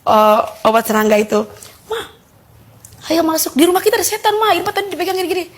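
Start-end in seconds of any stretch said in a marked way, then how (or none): 1.9-5.4 high-pitched mock yelling, no real volume; retold frightened cry. Daughter running out to tell mum of a devil, retold cry. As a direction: imitate calling out in alarm, pitched up. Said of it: "Saya teriak-teriak lah keluar".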